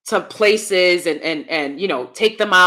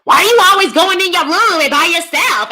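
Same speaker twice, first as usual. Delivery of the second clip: pitched-up louder nagging relatives voice; mocking family members; 8 semitones higher, 6 dB louder than usual. Vlogger mimicking nagging relatives, pitched up and loud. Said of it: "I have family members".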